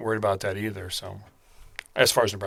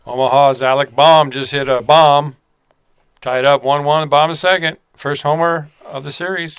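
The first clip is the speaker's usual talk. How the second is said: loud, excited play-by-play announcer call; radio home run call; 4 semitones higher, 12 dB louder than usual. Retold or imitated. Podcast host mimicking the radio call of a home run, loud announcer voice. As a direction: belt it out like the radio guy calling a homer.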